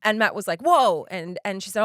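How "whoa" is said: louder, higher startled whoa. Retold or imitated. Husband startled as the babysitter speeds off, sudden whoa. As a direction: jolt of alarm at a lurching car.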